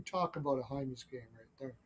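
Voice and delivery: usual speaking voice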